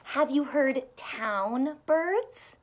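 plain reading voice

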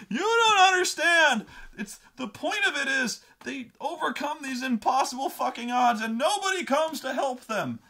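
high, loud, impassioned mock rant; hammed-up exasperated yelling; red rage. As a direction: imitate an enraged fan arguing at full pitch. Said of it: "going into the Red Rage".

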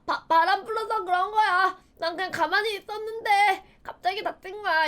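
put-on high, whiny child's excuse; mock wronged protest. 엄마에게 변명하는 아이 연기. (높은 톤으로 억울하다고 변명하는 아이 흉내)

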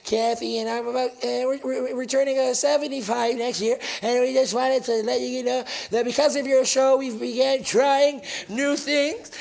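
halting, polite caller seeking advice; elderly lady caller. Retold or imitated. Seventy-five-year-old phones the show for advice, timid. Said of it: "Is this lady calling in, and she goes".

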